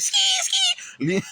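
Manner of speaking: very high rat screech; imitated rat squeal. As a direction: mimic a lunging rat's squeal. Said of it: "visions of the rat just like".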